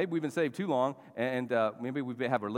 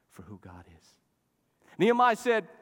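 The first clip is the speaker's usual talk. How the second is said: ordinary talk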